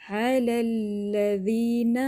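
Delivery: slow chant with a light doubled lam; thin lam recitation. Shown as a demonstration. Teacher models علی الذین with a light lam. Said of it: "موٹا لام نہیں پڑھنا۔".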